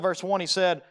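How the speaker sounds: ordinary talk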